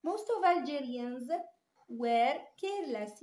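very slow, each word laid out; slow reading aloud. Shown as a demonstration. Teacher reading the example sentence to students, very slow. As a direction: dictate it to the class. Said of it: "teacher reads example sentence slowly".

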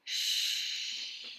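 soft whispered shh; wind hush. A narrator's reading. Wind in the leaves, whispered hush. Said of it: "It whispers".

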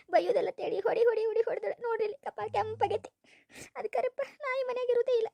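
high, weepy protest; acted wailing indignation; tearful complaint. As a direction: pile up the grievance in a high crying voice. Wife's staged tearful complaint to husband.

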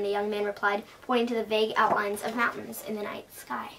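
usual speaking voice

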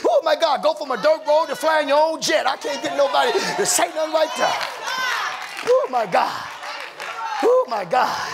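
loud, high, whooping sermon climax; preaching at full force. Acted. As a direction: rise into a shouted, sing-song preacher's whoop over the organ.

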